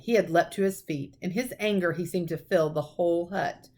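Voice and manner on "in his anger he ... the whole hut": usual speaking voice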